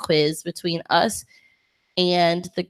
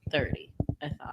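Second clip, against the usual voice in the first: whisper; 3 semitones lower, 11 dB quieter than usual. Real, not acted. Continues hushed aside.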